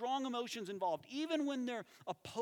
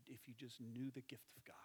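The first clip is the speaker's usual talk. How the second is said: whispered with compassion; hushed heartfelt plea; tender whisper; 7 semitones lower, 12 dB quieter than usual. Acted. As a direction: breathe it gently to her. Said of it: "empathized, and said".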